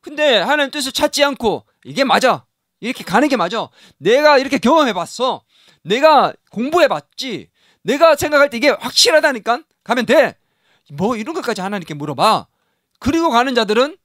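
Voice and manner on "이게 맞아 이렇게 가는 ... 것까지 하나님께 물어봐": self-sure insister. Acted. Voices a self-sure person.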